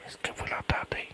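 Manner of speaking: very soft whispered question; hushed whisper. Pretend. Researcher checks caller hears the bird, whispering. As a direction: whisper so the bird stays put. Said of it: "Vous ne parlez pas très fort".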